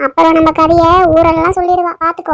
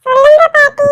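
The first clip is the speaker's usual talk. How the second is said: put-on little girl; 9 semitones higher than usual. Granddaughter answers her grandmother in a high child voice. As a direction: chirp it like a small granddaughter.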